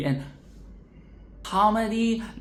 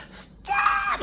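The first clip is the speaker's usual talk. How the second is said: very high shrieked cry; squealed Stop; laughing shriek. Real, not acted. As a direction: scream it in delighted disbelief. Laughing outburst at joke.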